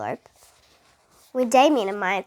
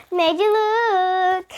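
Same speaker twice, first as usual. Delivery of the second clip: high, loud, slow teasing gotcha; gleeful taunt; 8 semitones higher, 6 dB louder, much slower than usual. A narrator's reading. Boy teasing Dana after his trick, gleeful taunt. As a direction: crow it at Dana like a playground prank. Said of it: "John exclaimed".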